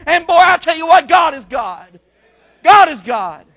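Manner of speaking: loud, high preacher's testimony; preaching. Acted. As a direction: preach it with rising fire to the church.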